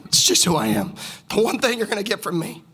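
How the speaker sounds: ordinary delivery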